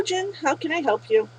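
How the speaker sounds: usual speaking voice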